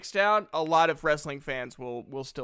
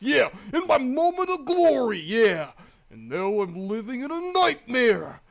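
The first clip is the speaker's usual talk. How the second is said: Macho Man promo. Retold or imitated. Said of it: "I used to warm up my voice with this".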